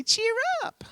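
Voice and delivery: tiny, very high sweet voice; soft falsetto sing-out, rising at the end; sweetly. Shown as a demonstration. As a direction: squeak it softly like a mouse. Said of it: "say it sweetly".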